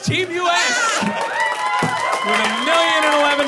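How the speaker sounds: loud, high game-show shout; winner announcement. Real, not acted.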